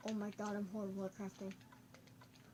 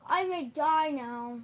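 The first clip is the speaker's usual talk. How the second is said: higher, slow shout; loud dismay; 4 semitones higher, 11 dB louder, slower than usual. Real, not acted. Gamer facing death in game, loud drawn-out dismay. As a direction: draw it out loudly. Exclaims over danger in game.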